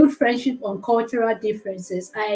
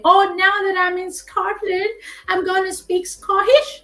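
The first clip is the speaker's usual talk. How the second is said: higher, playful voice of a show-off newcomer; mock boastful quote; 7 semitones higher, 5 dB louder than usual. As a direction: tease the kid who drops his own accent. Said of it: "don't say".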